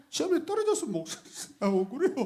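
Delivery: high mock whine; teasing a member's tearful complaint; mock whining. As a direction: whine like a sulking kid. Mocking a crying member's voice.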